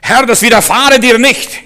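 high, loud, slow objection; Peter's protest. Acted. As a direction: protest forcefully to the Lord.

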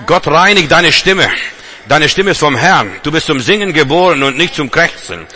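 shouted Halleluja sermon; full force preaching. Acted. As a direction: proclaim it at the top of your voice.

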